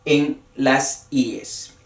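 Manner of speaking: "ies" said slowly and deliberately; slow stress on ies. Shown as a demonstration. Teacher slowly stressing "ies" for the class. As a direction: spell out "en las ies" slowly. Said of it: "el acento se lo coloca como en los regulares".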